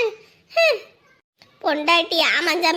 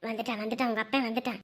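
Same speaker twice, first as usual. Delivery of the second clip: low, much softer urgent warning; urgent hush; 10 semitones lower, 11 dB quieter, faster than usual. Acted. Grandmother warning the boy his father has arrived, urgent and hushed. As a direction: hiss the alert under your breath.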